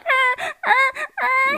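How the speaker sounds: high braying donkey noise. Retold or imitated. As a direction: bray like a donkey. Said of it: "He's like".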